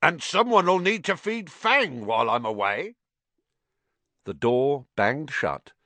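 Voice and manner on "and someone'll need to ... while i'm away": raised voice, higher and louder. A narrator's reading. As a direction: call it out for hidden ears. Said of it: "said loudly".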